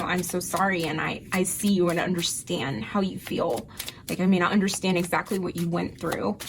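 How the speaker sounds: ordinary talk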